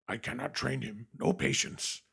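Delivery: solemn little creature croak; grave, resigned puppet-sage voice; solemn Yoda. Retold or imitated. Yoda giving up on impatient Luke, solemn. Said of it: "solemns up".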